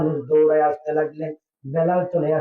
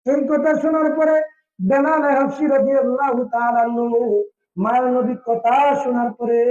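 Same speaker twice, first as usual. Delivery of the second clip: high, chanted sermon narration; melodic preaching; 8 semitones higher than usual. A narrator's reading. Preacher telling the crowd of Bilal's response, high chanted preaching. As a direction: carry each phrase high with a sustained ending.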